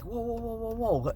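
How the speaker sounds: high, soft startled whoa, whoa; a sudden catch at the last moment. Retold or imitated. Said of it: "we're like".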